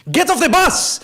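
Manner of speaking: high, loud yell; screaming bus driver. Retold or imitated. Bus driver ordering passengers off, screaming. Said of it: "sort of screaming at them".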